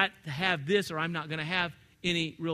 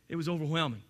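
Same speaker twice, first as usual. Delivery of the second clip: ordinary talk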